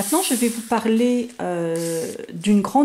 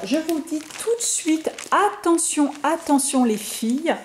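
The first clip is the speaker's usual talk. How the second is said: higher-pitched heads-up to the viewers; emphatic warning; 7 semitones higher than usual. Real, not acted. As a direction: raise the voice to flag something important. Warns viewers about limited edition. (mise en garde appuyée, voix plus aiguë)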